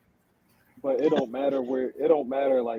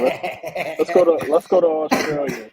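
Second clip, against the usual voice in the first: laughing while talking; 3 semitones higher, 8 dB louder, slower than usual. Real, not acted. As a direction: giggle through the words. Joking along, laughing.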